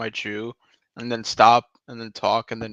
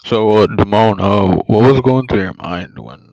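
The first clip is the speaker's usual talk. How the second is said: mock interviewer; 13 dB louder than usual. Mock interviewer questions friend.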